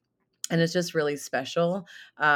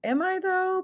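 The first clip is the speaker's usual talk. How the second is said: high doubtful self-question. Retold or imitated. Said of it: "and now I'm like".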